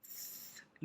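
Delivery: a silent breathy "Th-"; voiceless th hiss. Shown as a demonstration. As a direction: push air through the teeth for "Th-". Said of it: "looks like you're lis".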